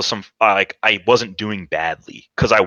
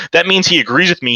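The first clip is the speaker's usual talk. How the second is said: ordinary talk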